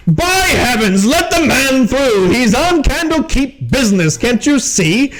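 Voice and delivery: high, indignant commanding call; Captain Zod. Acted. Captain orders guard to admit the wizard, indignant. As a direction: call across a crowd as an officer. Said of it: "you hear Captain Zod".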